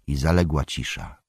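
usual speaking voice

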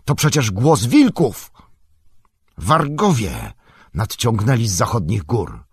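loud alarmed warning; cry out. A narrator's reading. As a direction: raise the alarm to the company. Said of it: "zawołał". (głośne ostrzeżenie)

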